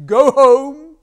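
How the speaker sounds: high, very loud command; royal order. Acted. King dismisses the farmer, loud order. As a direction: bellow it to send him away.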